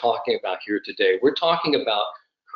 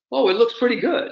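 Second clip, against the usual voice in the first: much higher-pitched imitated English South African voice; English South African accent; 11 semitones higher, faster than usual. Golf instructor reacting to a pupil's grip, high English South African accent. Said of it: "that great English South African accent of his".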